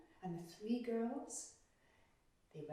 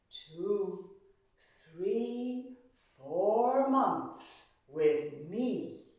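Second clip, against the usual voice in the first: low, slow and loud royal decree, counting out the seeds; stern King Pluto; 4 semitones lower, 6 dB louder, slower than usual. Acted. Pluto tells Hermes his terms, low, slow and loud. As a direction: lay down the law like an underworld king.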